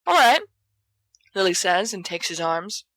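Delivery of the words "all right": bright agreement. Acted. Lily's sudden agreement.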